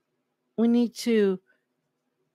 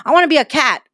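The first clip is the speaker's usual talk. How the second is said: put-on loud, higher, eager child's wish; excited little kid; 5 semitones higher, 9 dB louder, faster than usual. Preacher plays a child announcing a silly dream, loud and high. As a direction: pipe up louder and higher like a small kid. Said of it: "let a kid be".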